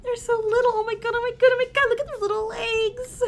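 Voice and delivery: high-pitched gush over cute little things. Acted. Fan of frogs cooing over their tiny legs, gushing. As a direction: squeal over something tiny and adorable.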